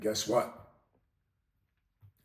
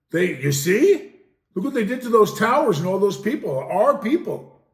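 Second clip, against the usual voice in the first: mock outrage; 7 semitones higher, 9 dB louder than usual. Mocking outraged patriotic pitch.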